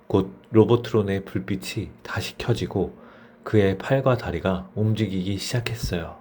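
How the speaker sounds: ordinary delivery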